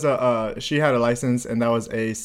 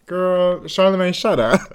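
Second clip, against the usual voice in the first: ordinary delivery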